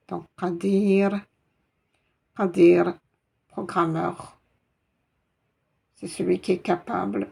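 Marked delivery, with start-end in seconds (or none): 0.4-2.9 « Qadir » plus fort, le r final muet de voyelle; finale sans voyelle. Shown as a demonstration. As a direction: couper net après le r de « Qadir ». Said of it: "comme s'il y avait une soukoun". (prononcer « Qadir » comme avec soukoun)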